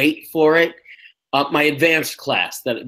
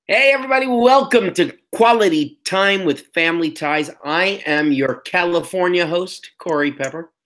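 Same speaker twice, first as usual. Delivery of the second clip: announcer voice; 4 semitones higher than usual. Acted. Podcast host opening announcement.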